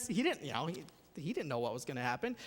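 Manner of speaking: normal talking voice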